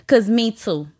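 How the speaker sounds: normal talking voice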